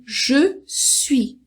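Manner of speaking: je suis as two full, separate words; formal French pronunciation. Shown as a demonstration. Teacher telling what French people don't always say, formal je suis. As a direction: keep je whole, pause, then suis. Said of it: "É o mais formal".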